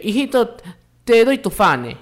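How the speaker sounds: high, lightly voiced mother mixing up J and F in "fane"; a loving imitation of mom's village accent; fond impression of mom's Amazonian accent; imitating mom's San Martín speech. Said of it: "Mi mamá habla así".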